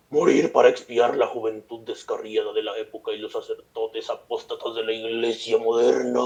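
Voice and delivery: slow, drawn-out possessed voice; theatrical quote of the possessed; possessed woman's ranting. Acted. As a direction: act out a slow exorcism outburst, dragging the last word. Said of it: "La orillaron a vociferar".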